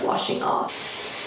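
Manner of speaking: stiff cheeks, lips free; talking through mask stiffness; dried face mask on. Real, not acted. Seen 2: Clay mask covers whole face while speaking; lips left uncovered.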